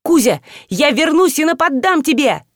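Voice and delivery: high, called-out threat over the shoulder; threatening Kuzya while dashing to the door; shouting while running. A narrator's reading. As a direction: yell it back while running out. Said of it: "крича на ходу".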